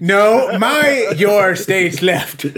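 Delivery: exasperated. Real, not acted. Exasperated directing friend.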